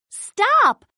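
high urgent cry. A narrator's reading. Twins cry out at the running dogs, high urgent call. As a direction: call out high to stop them. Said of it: "the twins cry".

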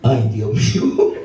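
slow, laughing exclamation; a slow sigh of amused defeat; real laughter. Real, not acted. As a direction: let the laugh stretch the words out. Laughing at the mother.

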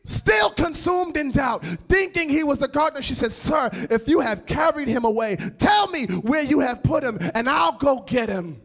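loud, high, rising sermon cry; full-throated preacher's climax; preaching at full force. Acted. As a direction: preach it to the back row.

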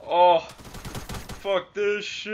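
sudden loud yell of disgust at the game; frustrated yell. Real, not acted. Gamer yelling at his game, loud and fed up.

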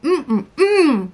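chewing while humming; muffled by food; mouth full of sushi. Real, not acted. Seen 0: cheeks bulge chewing sushi bite taken at -9; talks by 3.0.

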